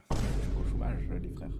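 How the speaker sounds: whispered Je suis pas au chômage, as if it's a secret. Shown as a demonstration. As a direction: hush it so the chat can't hear. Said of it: "Dinguerie de, de dire ça devant tout le monde".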